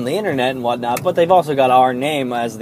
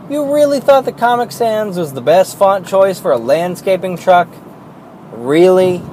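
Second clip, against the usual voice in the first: high, scornful disbelief; mock them in disbelief; incredulous rant; 7 semitones higher, 4 dB louder than usual. Real, not acted. Ranting at a truck's font.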